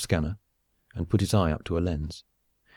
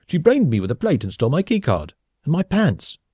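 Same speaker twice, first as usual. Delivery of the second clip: high, loud, indignant complaint; aggrieved scientist, exasperated; 8 semitones higher, 5 dB louder than usual. A narrator's reading. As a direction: report the humiliation with rising outrage. Gagged man reports the escape.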